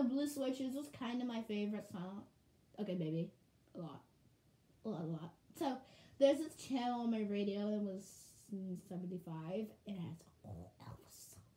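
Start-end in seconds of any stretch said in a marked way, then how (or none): none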